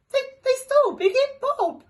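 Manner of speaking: high, loud sobbing wail. Acted. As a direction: wail it through sobs. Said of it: "started to sob".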